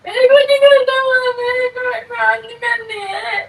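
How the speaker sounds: tearful, high-pitched pleading; crying while refusing to talk. Real, not acted. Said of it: "रो क्यों रही है?". (रोते हुए)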